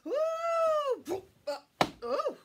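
high, loud squeals with laughter; mock thrill ride. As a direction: pretend you're soaring over a drop. Pretend scary flight.

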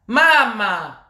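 loud, drawn-out call. Real, not acted. Ranter calling on a mama, loud drawn-out appeal. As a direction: stretch it out to get her attention. Calls mama louder on repeat.

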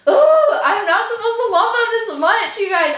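high, loud fangirl wail; overwhelmed gushing. Real, not acted. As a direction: wail helplessly about loving them too much. Overwhelmed by affection for the band.

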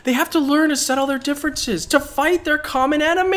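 high, urgent protest; indignant. Real, not acted. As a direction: plead against giving up. Outraged protest.

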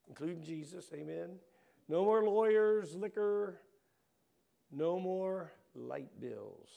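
punchy rising preacher cadence, line after line; fiery preaching. Acted. Preacher rousing the church about heaven, fiery and rhythmic.

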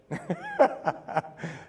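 short real laugh. Real, not acted. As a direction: laugh it off warmly. Laughs at God's answer.

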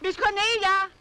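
loud searching call. Acted. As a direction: shout her name across the house. Searching house, calling out.

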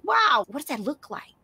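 high, loud amazed exclamation; amazed wow. Retold or imitated. Author recalling her discovery of the queen, amazed. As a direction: gasp it out in wonder. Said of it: "I was like".

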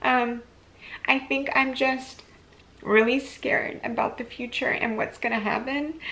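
real tears welling up mid-sentence; getting emotional. Real, not acted. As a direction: voice climbing high, fast and tearful, breaking into a laugh. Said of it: "I'm getting really emotional".

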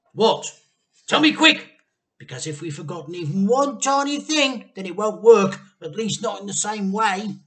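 high, loud urgent plea; desperate to hear it quick; anxious, eager dad. A narrator's reading. Mister Cranky begging George to tell him fast, urgent.

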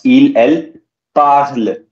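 parlent said as parle; silent ent in parlent. Shown as a demonstration. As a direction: drop the ending of parlent after the l. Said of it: "Same thing with the".

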